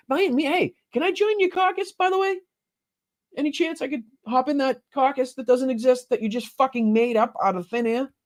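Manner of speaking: high, sugary fake-polite voice; sarcastic mock plea. Mocking asking to join caucus.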